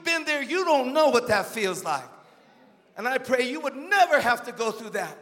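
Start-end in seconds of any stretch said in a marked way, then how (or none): none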